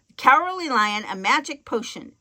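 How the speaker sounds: ordinary delivery, nothing marked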